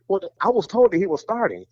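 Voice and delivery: high, fast, defensive protest. Retold or imitated. His retold protest.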